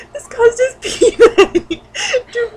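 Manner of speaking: high, slow, laughing through the words. Real, not acted. Reader attempting the funny word, breaking into high laughter. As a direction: try to read it but keep cracking up. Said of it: "I can't say it without laughing".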